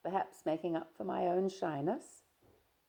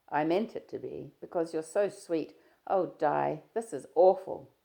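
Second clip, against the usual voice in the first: a louder, lightly voiced young man, breezy; Stephen, teasing; 7 dB louder than usual. Stephen greeting his shy friend, easy and teasing. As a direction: play Stephen chatting easily, a touch louder.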